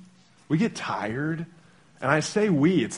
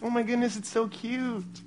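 adoring parents; 8 semitones higher, 7 dB quieter than usual. Retold or imitated. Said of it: "to us, it's like".

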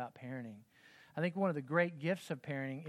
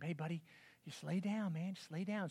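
put-on soft, hushed coaxing to a crying baby; soothing the baby; 6 dB quieter than usual. Dad at the crib at 4am, softly coaxing. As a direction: gently talk the baby back down.

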